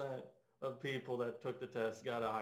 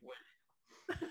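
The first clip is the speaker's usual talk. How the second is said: whispered confusion; 8 dB quieter than usual. Retold or imitated. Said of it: "And I'm like".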